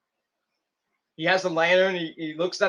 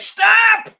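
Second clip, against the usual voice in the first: much higher and louder, a sharp command; commanding shout; 9 dB louder than usual. Retold or imitated. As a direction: stop the brawl with one yell. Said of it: "he's like".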